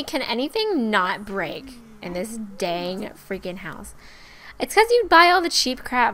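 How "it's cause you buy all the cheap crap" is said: high, loud mock scolding; loud, high-pitched chiding; mock reproach. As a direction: play a nag telling you off. Voices a reproach to herself.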